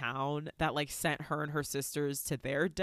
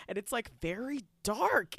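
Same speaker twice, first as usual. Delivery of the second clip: usual speaking voice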